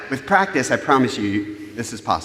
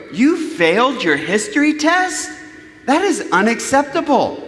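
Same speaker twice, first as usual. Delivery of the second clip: higher, indignant lecture in full flow; scolding parent; 3 semitones higher than usual. Acted. Parent to teenage daughter after a failed history test, scolding. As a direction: play the outraged parent laying down the law. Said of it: "We go".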